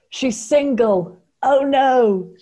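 mock horror. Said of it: "and hers is".

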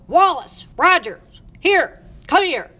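sharp, high-pitched yells; shouting. A narrator's reading. Matron calling her staff over, shouted. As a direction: yell each word as a summons. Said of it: "shouted Miss Loud".

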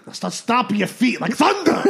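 loud, high, hammed-up order; commanding yell. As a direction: build to a shouted command. Drunk man demands thunder in joke.

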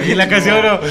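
high, loud words through laughter; cracking up mid-story; laughing. Real, not acted. Friend laughing while telling about the ex's story.

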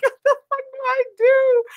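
high-pitched laughing wail; mock panic. Retelling her panic at the incident, laughing mock despair. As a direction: wail helplessly through laughter.

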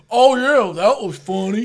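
very loud heckling shout; booming mock approval; imitated heckler jeer. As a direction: blare it sarcastically from the bar. Drunk heckler jeering at a joke.

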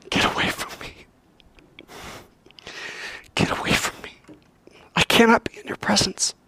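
acted anguish, hushed and voiceless; tearful whisper. Peter at Jesus' knees, acted tearful whisper. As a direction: plead in a broken whisper, as if bawling. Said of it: "bawling his head off".

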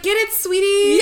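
high, loud egging-on through laughter; mock proud whoop for a pupil; cheering teacher. Teacher cheering the singer on, laughing mock encouragement.

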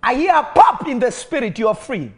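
high, loud declarations of healing; full-force preaching. Acted. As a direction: call out each illness and break it.

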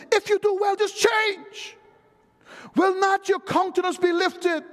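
high, shouted preaching; preacher's exhortation. Acted. God urging Cain to change, high shouted exhortation. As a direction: cry it out as an urgent command.

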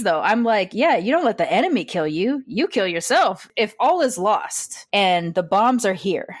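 usual speaking voice

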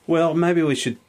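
ordinary delivery

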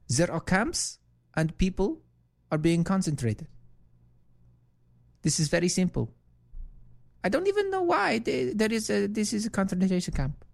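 exaggerated mocking impression of a congresswoman; mocking Omar impression. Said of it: "Here's what she said".

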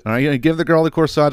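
usual speaking voice

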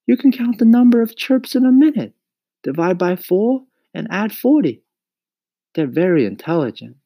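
put-on high, loud boyish voice; insistent boy Mario. A narrator's reading. Mario defends crickets to Mama, high and insistent. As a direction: argue the point high and emphatic.

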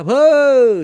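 put-on sudden loud high shout of an old monk. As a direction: bark it out at the children. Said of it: "Părintele Cleopa care striga".

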